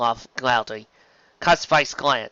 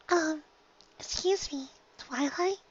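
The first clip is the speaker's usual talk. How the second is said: much higher, softer shy little voice; Fluttershy timid; 8 dB quieter, slower than usual. Acted. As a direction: barely dare to speak up.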